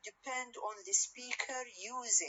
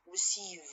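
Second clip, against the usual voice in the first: "receive" as re-CEIVE, final syllable stressed; stress on last syllable. Shown as a demonstration. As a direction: lean on CEIVE in "receive", keep "re" light. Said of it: "the last part or the last syllable".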